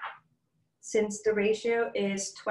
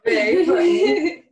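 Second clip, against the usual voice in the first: high, loud, drawn-out laughing cry; slow, very loud, giggly outburst; laughing squeal; 9 semitones higher, 11 dB louder, slower than usual. Real, not acted. Reacting to strong rose oil.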